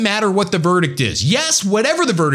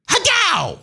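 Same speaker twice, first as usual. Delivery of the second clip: ninja cry. Acted. Sign-off catchphrase.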